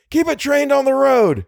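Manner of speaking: high-pitched shouted order; urgent shout. A narrator's reading. Driver orders the light aimed ahead, shouting. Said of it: "shouted Little".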